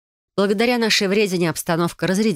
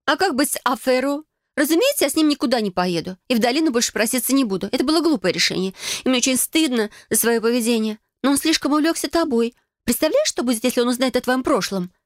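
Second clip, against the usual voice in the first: higher-pitched, agitated voice; earnest sister; 8 semitones higher than usual. Acted. Sister worrying about Adele and Afero, agitated. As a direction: talk it through urgently, pitched up.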